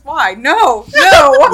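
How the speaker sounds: mock anguished protest. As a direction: cry out in hammed-up horror at the gift. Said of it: "and he just goes".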